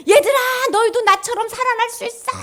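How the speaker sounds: put-on high, loud, triumphant call to the children; joyful call. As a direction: announce it joyfully, like a victory. Jesus calling out to the children.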